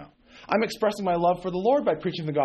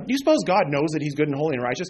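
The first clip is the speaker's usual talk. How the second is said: ordinary talk, nothing marked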